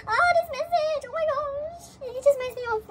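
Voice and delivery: high, loud, touched squeal; overcome, wail it out fondly; emotional gushing. Real, not acted. Fan reminded of talking to him, emotional gushing. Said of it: "I'm emotional".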